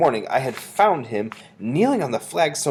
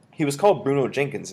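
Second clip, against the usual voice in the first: ordinary delivery, nothing marked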